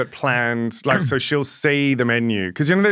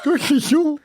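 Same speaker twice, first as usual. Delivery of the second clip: mocking an Invisalign lisp; 13 semitones higher, slower than usual. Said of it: "She's going".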